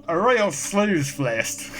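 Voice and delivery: slow, drawn-out Australian accent attempt. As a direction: stretch it out in a hammy Australian accent. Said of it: "Am I going to do the accent?".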